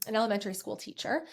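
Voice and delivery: normal talking voice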